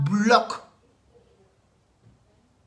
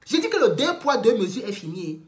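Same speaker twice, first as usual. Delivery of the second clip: ordinary delivery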